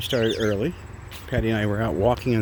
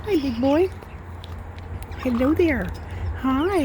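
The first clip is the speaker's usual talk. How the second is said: pet talk. Real, not acted. Greeting the parrot Aussie.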